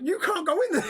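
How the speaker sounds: very high, loud, hammed-up protest; mock outraged shout. Stranger shouting as the gate opens, mock outrage. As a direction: imitate helpless outrage, half laughing.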